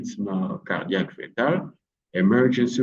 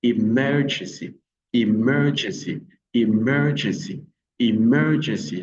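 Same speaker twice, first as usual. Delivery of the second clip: "emergency" with MER stretched and punched; stress on MER. Shown as a demonstration. Teacher modelling for learners, "emergency" stressed on MER. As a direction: hit the second syllable of "emergency". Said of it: "L'accent est placé sur mer".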